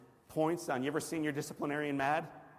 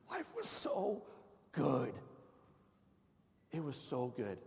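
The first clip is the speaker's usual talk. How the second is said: dreamy nostalgia; 3 dB quieter, slower than usual. Real, not acted. Savouring the good old days.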